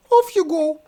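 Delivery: very high, prim princess voice; haughty princess. Acted. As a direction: dismiss them coolly. Princess dismisses.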